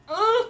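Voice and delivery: high, loud acted "ugh"; frustrated groan. Throwing a tantrum alone in her room, high frustrated groan. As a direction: burst out in mock exasperation. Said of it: "'cause I was so frustrated".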